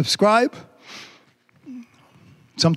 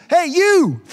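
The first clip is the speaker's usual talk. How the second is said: higher, calling out as God; 13 semitones higher, 4 dB louder than usual. Acted. Preacher voicing God calling out to the reader. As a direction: call out to the listener as God. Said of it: "this is God saying".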